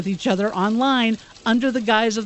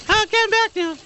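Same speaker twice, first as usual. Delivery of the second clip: falsetto woman voice; 12 semitones higher than usual. Pretend. Back in the fake woman voice.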